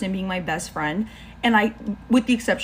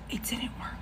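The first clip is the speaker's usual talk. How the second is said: much softer whisper, barely voiced; whispered aside; 4 semitones higher, 12 dB quieter than usual. Real, not acted. Storyteller confessing the spell failed, in a whisper. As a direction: drop it like a secret. Deadpan confession then laughing.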